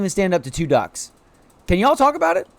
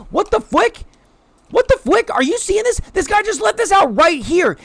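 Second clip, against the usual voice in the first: much higher and louder, incredulous; can't believe what he's reading; shocked disbelief; 12 semitones higher, 4 dB louder than usual. Real, not acted. Reading the villain's diary aloud to chat, shocked and incredulous.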